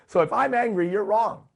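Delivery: indignant version; loud mock indignation. Shown as a demonstration. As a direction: throw it out like an offended know-it-all. Repeats the quoted line twice.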